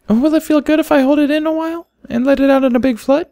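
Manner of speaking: a shy little filly confessing; little foal voice. A narrator's reading.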